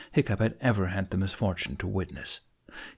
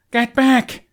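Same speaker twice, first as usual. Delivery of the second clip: much higher, much louder yell down a cliff; bellow; 10 dB louder than usual. A narrator's reading. Hiccup warning the Vikings below, desperate bellow. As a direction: roar the warning to people far below. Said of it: "Hiccup bellowed down to the Vikings".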